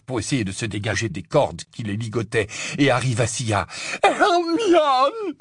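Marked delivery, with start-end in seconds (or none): 4.0-5.3 high, full-force howl; Ron's desperate scream. A narrator's reading. Bound prisoner crying out for his tortured friend, desperate scream. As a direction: yell her name up through the ceiling. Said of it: "hurla Ron".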